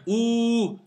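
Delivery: OU held long and steady; long vowel. Shown as a demonstration. Teacher naming the long vowel for pupils, held out. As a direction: sustain "Ou" as one drawn-out vowel. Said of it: "c'est la voyelle longue".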